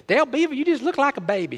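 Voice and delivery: a hint of a fond Louisiana mother-in-law. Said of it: "she would always say to me".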